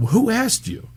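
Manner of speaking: high mock retort, "Who asked you?" pitched well up; retorting to critics. Dad answering online critics with a high mock retort. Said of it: "I was like".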